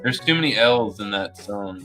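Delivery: usual speaking voice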